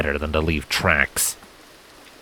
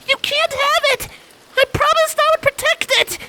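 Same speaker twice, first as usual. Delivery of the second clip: much higher, trembling defiance; cling to the promise, scared; shaking filly voice. A narrator's reading. Primrose refusing Silent from the alley, high shaky defiance. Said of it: "Primrose's shaking voice barked back".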